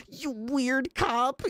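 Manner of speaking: lento y agudo, arrastrado; mocking troll. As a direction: estira cada palabra con burla. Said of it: "was like".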